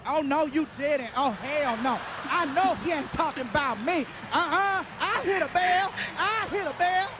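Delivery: high, sassy outrage; sassy retort. Retold or imitated. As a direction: talk back with attitude. Imitating audience reaction.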